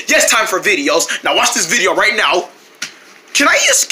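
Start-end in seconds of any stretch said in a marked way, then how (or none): 3.3-3.9 very high squeak; high squeaky voice. Acted.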